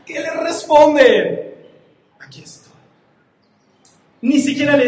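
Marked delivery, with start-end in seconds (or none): 2.2-2.7 tiny hushed reply of submission; acted meek whisper; humble whispered answer. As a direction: whisper it, almost no voice. Voices Abraham's humble answer.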